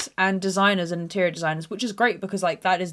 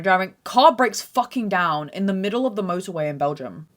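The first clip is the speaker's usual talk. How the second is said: ordinary talk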